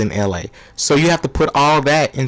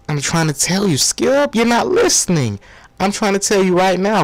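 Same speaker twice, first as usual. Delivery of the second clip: pleading impression; 6 semitones higher than usual. Broussard pleads with Skip.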